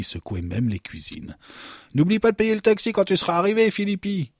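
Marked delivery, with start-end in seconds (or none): 1.9-4.3 high, quick, loud call. A narrator's reading. Chief guard sending Philippi off in the wheelbarrow, calling out. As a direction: call teasingly after someone leaving. Guard calls after departing detainee.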